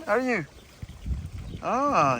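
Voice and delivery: normal talking voice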